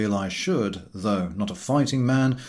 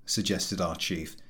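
ordinary delivery

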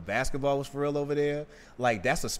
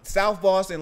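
louder and higher, insisting; loud and emphatic; 8 semitones higher, 7 dB louder than usual. Real, not acted. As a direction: press the point hard. Heated point about Boston accents.

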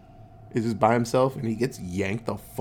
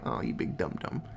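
breathy mocking whisper to the listener; soft unvoiced jab at a silly hero; whispered aside; 7 semitones lower, 10 dB quieter than usual. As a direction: whisper it like a teasing secret. Host mocking Ernest aside.

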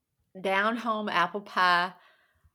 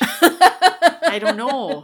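put-on slow, drawn-out Minnesota accent said through laughter; a laughing stab at a Minnesota accent; 5 semitones higher, 10 dB louder, much slower than usual. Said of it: "Can you do a Minnesota accent?".

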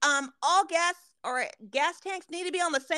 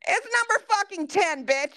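exasperated punchline; 8 semitones higher, 4 dB louder than usual. Real, not acted. Punchline of gas pump story.

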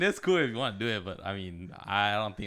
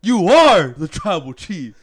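much higher, much louder, slow; mock acknowledgement shout; 8 semitones higher, 14 dB louder, slower than usual. Co-host hailing the host's mock promo, loud. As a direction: roar it back like a crowd.

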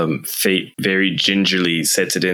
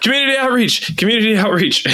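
much higher, panicked repeated shout; frantic shout; 13 semitones higher than usual. Acted. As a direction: yell it before the machine picks wrong. Player frantically picks an option.

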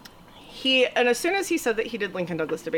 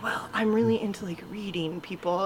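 whispered, fast "Well, I'm really into" dropping quieter; creepy hushed quote; 5 semitones lower, 7 dB quieter than usual. Retold or imitated. Voicing her date's pickup line, hushed and creepy. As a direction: hush it like a creepy confession.

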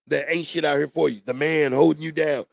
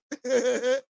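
real laugh; 6 semitones higher than usual. Real, not acted. Laughs at his own joke.

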